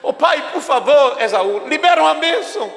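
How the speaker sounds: high, shouted begging cry; desperate plea. Acted. Esau begging his father, shouting high and desperate. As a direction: shout up at him, begging for the blessing.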